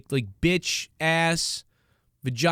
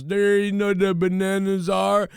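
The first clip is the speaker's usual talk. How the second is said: imitating a slow-talking guy; 3 semitones higher than usual. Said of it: "he goes".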